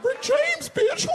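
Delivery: very high, loud squeaky cartoon shout; Mickey Mouse squeal. Mickey Mouse yelling the catchphrase at the comic, squeaky.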